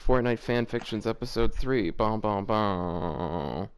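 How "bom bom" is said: ordinary talk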